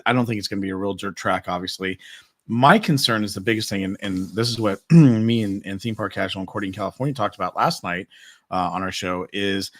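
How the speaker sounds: ordinary delivery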